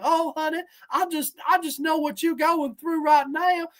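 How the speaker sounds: high Tennessee pear voice; Tennessee accent. Acted. Talking pear consoling the hungry woman, Tennessee accent. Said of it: "the pear has a Tennessee accent".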